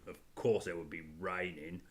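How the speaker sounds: low, soft Cockney grumble. Acted. Father voicing Edmund as a grumbling Cockney. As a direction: grumble it in a middle-aged Cockney. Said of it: "becoming Cockney".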